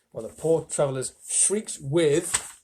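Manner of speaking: ordinary delivery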